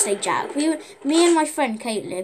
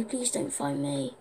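lower, slow, hushed whisper; barely breathing the plea; whispering while hiding; 9 semitones lower, 7 dB quieter, slower than usual. Real, not acted. Hiding from enemy in game.

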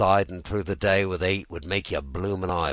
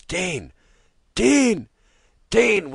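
higher and louder calls, rising on each name; shouted calls; 9 dB louder, much slower than usual. Acted. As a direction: call him through the gunfire. Calls under fire.

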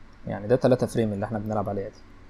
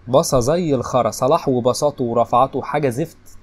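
louder, slow, fed-up complaint; angry rant; 5 dB louder than usual. Real, not acted. Gamer ranting about Salah's bad passes, slow and angry. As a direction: spit out the disgust, drawn out.

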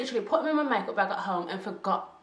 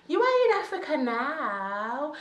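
higher, slow, put-on mum voice; stern mum; 5 semitones higher, slower than usual. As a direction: lay down the law like a strict African mum. Mum retorting about beating kids.

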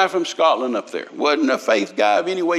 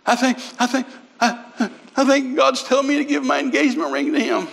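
high, halting mock-tearful wife; imitated crying; 9 semitones higher than usual. Wife beside her husband during the offering, imitated crying. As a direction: stammer it out in a pitched-up sob. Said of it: "she's crying".

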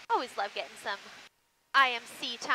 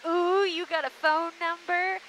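teasing commenter; 6 semitones higher, 3 dB louder, slower than usual. Retold or imitated. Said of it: "people in the comments that are like".

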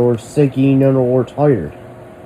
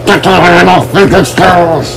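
a full-throated, much louder yell; furious shout; 4 semitones higher, 10 dB louder than usual. Acted. Ordering someone off his stairs, screaming. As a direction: blast him off the stairs.

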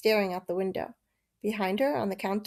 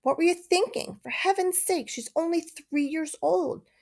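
angry dad; 8 semitones higher than usual. A narrator's reading. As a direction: scold her, fed up and upset. Dad angry over spilled juice.

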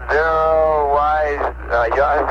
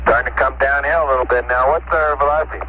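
crackly spacecraft radio voice; tinny voice over a radio link; astronaut radio; 4 semitones higher, faster than usual. Real, not acted.

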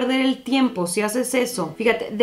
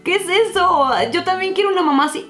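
amazed disbelief; 8 semitones higher than usual. Retold or imitated. Young speaker amazed at friend's easygoing mother, astonished. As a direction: gasp it out in delighted disbelief.

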